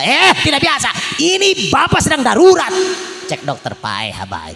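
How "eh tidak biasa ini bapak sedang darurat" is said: urgent protest. Acted.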